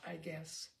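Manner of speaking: plain reading voice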